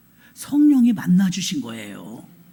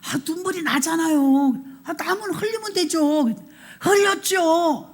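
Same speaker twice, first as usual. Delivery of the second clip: embarrassed man; 6 semitones higher, slower than usual. Retold or imitated. Man embarrassed about crying.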